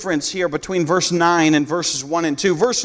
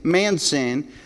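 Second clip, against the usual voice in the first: ordinary delivery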